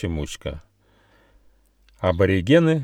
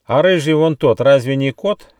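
high, loud challenge; bandicoot's raised, suspicious cry; accusing shout; 6 semitones higher, 6 dB louder than usual. A narrator's reading. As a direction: call out an accusation. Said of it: "крикнул длинноносый бандикут".

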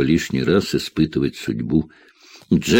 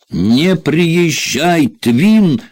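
high, drawn-out cry, louder at the end; warning shout; 10 semitones higher, 4 dB louder, much slower than usual. A narrator's reading. Jack warns Tvin not to come, shouting. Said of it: "крикнул Джек".

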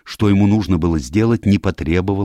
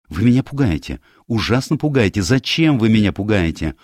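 higher, agitated outburst of questions; alarmed confrontation; 6 semitones higher, 4 dB louder than usual. Acted. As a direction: press him with mounting exasperation and fright. Frightened Diana confronts silent Poirot.

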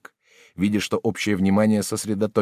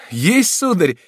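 triumphant reply; 12 semitones higher, 8 dB louder, slower than usual. A narrator's reading. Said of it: "победоносно отвечал".

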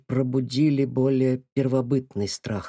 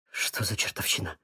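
whisper; 4 semitones lower, 7 dB quieter than usual. A narrator's reading. Terrified under-breath exclamation.

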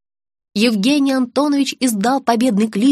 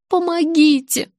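high, slow, tearful cry for help; plaintive, drawn-out appeal; sobbing plea; 5 semitones higher, slower than usual. A narrator's reading. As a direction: beg with a mild sob. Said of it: "всхлипнула тётушка с пирогом".

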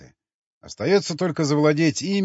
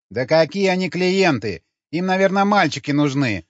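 hurt protest at a higher pitch; offended grumble; 4 semitones higher, 4 dB louder than usual. A narrator's reading. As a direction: grumble back, put out. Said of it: "обиженно пробубнила она". (обиженно, повышенным тоном)

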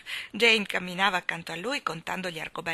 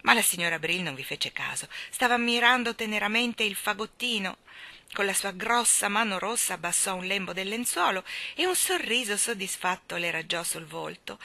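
ordinary delivery, nothing marked

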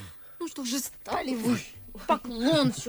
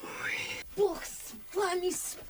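whispered gasp; 7 semitones higher, 8 dB quieter than usual. Acted. Startled maid, master approaching.

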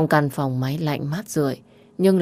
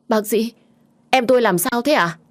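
high, louder anxious question to the doctor; frightened plea; 7 semitones higher, 4 dB louder than usual. A narrator's reading. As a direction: ask the doctor in rising fear. Sister begs doctor about brother. (lo sợ hỏi bác sĩ, giọng cao và to)

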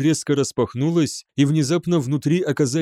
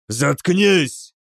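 rough, higher forceful jailer's order; growled; 4 semitones higher, 4 dB louder than usual. A narrator's reading. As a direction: growl him into silence. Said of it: "прорычал старший". (рычит)

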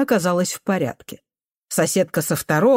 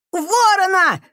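put-on very high boy's cry; cornered cry; 13 semitones higher, 4 dB louder, slower than usual. A narrator's reading. Boy crying into the janitor's face, desperate. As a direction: cry it out like someone trapped. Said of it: "загнанно крикнул мальчик".

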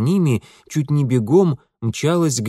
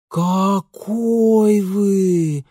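high, very slow, stretched words; drawn-out admiration; 8 semitones higher, 4 dB louder, much slower than usual. A narrator's reading. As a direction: draw it out with pensive respect. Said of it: "протянули сёстры даже с некоторым задумчивым уважением".

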